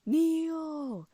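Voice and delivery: high ringing call of a name. A narrator's reading. Loki calling Neil inside her head, a high loud call.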